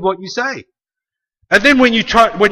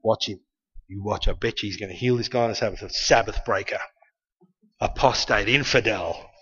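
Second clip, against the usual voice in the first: low, soft conspiratorial mutter; suspicious muttering; 8 semitones lower, 8 dB quieter than usual. Retold or imitated. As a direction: whisper it like a suspicious plotter. Religious rulers whispering suspicion.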